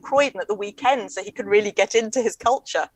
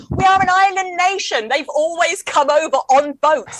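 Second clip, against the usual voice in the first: higher, louder mock-exasperated comeback; exasperated retort; 6 semitones higher, 4 dB louder than usual. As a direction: protest as if stating the obvious. Acted retort to the cafe man.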